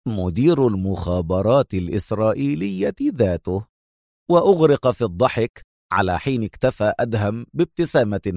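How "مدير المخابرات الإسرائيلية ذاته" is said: ordinary delivery, nothing marked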